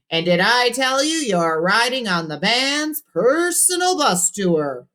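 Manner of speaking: high, slow showy radio host. A narrator's reading. Cousin Ralphie answering the caller, showy radio DJ. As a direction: sell it like a DJ on air.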